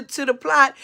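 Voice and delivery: ordinary talk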